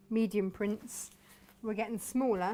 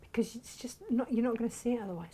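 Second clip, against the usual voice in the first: ordinary talk, nothing marked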